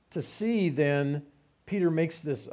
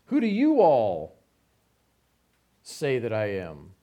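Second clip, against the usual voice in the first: Southern "you all" with weight on "you"; drawled you all; 3 semitones lower, 5 dB louder than usual. Shown as a demonstration. Preacher stressing "you all" to the congregation. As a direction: lean on the plural "you all". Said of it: "Now, I add that "you all" in there".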